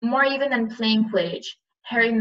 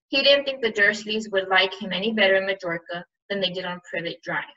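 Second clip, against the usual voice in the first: ordinary delivery, nothing marked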